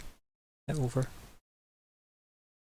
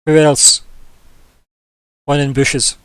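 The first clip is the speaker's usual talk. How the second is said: loud booming dumb giant; dopey cartoon bellow; 2 semitones higher, 8 dB louder, slower than usual. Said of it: "And he says".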